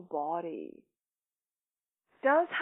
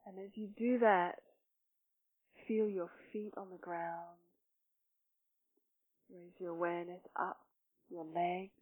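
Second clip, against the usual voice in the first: guided meditation; 3 semitones lower, 8 dB quieter than usual. Real, not acted. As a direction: gently guide them into breath and body, softly. Leads a breathing meditation.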